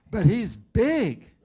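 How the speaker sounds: put-on high, slow, loud child's plea, half-crying; whimpering child. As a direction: play the bullied little boy whining to his teacher. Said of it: "whimpering and crying".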